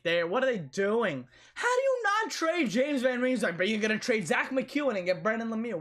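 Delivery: high, incredulous outburst at the GM; frustrated fan venting; exasperated rant. Real, not acted. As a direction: pitch it up in disbelief at a bad trade. Fan ranting about Flyers trades.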